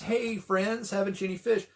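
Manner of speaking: high, loud teasing hail; heckling call. Retold or imitated. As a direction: tease the tired fishermen from shore. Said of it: "somebody heckling you from the shore".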